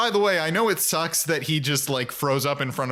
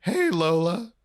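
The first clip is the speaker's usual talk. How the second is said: mock-terrified greeting, pitched up; 5 semitones higher, slower than usual. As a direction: imitate a freaked-out boy squeaking out hello. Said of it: "he looks terrified".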